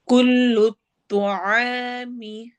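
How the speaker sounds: slow, drawn-out tajweed chant; Quran recitation. Shown as a demonstration. Teacher recites verse for students, slow tajweed chant. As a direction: recite it slowly, stretching each sound. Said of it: "इसको heavy मोटा करना है".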